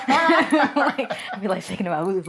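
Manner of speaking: loud, high laughing admission. Real, not acted. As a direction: laugh through the words, giddy. Laughing self-description.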